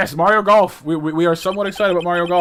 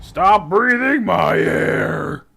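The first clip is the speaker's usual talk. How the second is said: drawn-out, loud crazed yell; intense outburst; 3 semitones higher, 6 dB louder, much slower than usual. Acted. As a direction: stretch every word into a furious roar. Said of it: "Got very intense there".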